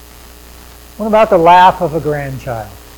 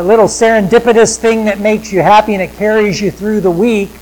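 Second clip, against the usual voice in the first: ordinary talk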